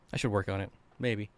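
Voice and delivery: usual speaking voice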